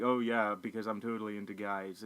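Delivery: low, softening sarcastic quote; understated mock agreement; snarky. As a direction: drop the voice into dry sarcasm. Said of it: "Basically her being snarky".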